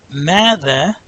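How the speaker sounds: Mada with both a's held long; long vowels. Shown as a demonstration. Teacher showing two long syllables in Mada. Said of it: "'cause the meme has aleph".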